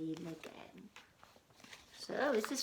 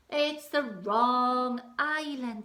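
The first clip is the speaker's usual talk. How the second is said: pirate disappointment; 5 semitones higher, 11 dB louder, slower than usual. Acted.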